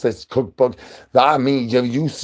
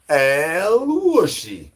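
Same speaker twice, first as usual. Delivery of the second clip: high drawn-out call; 7 semitones higher, much slower than usual. Acted.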